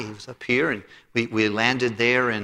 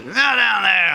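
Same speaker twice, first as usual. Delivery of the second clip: curt, loud and higher grumpy guard; a pastor mimics a grumpy guard brushing off a traveller, gruff and loud; 9 semitones higher, 7 dB louder than usual. Bark "Not down" as a grumpy guard would. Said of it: "grumpy old guard".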